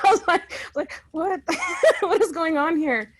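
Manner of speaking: high, loud laughing what; shocked laugh at the absurdity; laughing disbelief. Retold or imitated.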